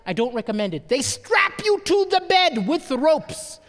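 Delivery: ordinary talk, nothing marked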